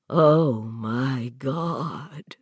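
low stunned gasp; horrified. A narrator's reading. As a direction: say it with dawning horror. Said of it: "his voice rising thinly".

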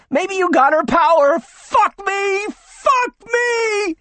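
very high, loud, crying shout; frantic shouting. Acted. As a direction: shriek it in a frenzy. Hysterical crowd crying out.

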